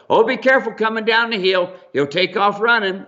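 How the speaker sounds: high, fussing lady voice; mock worried woman. Mule owner cautioning Steve on the way down, high and worried. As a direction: fuss over him like the anxious owner.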